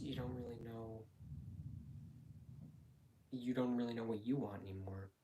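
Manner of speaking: ordinary delivery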